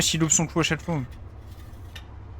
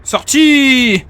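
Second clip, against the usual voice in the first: loud, high victory yell; triumphant shout; 8 semitones higher, 9 dB louder than usual. Real, not acted. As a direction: shout out the win. Tank finally destroyed.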